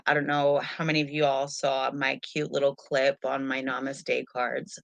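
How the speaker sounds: ordinary delivery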